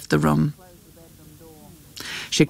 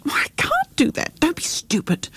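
very high, dismissive protest; flustered refusal; 10 semitones higher than usual. A narrator's reading. Mother rejects her daughter's suggestion, flustered. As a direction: brush it off in a leaping high pitch.

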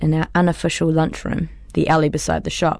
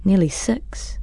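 ordinary delivery

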